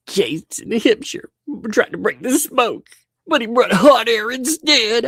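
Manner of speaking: very high mock prepubescent voice; cracking boy voice. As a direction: squeak it out like a boy whose voice is breaking. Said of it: "You need to do the voice cracking for him".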